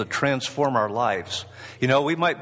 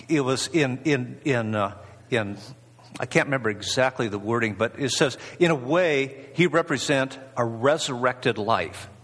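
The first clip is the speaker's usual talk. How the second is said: ordinary talk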